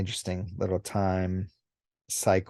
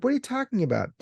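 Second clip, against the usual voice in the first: much higher, incredulous question; baffled human; 14 semitones higher, much faster than usual. Retold or imitated. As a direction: sound genuinely baffled. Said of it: "He's like".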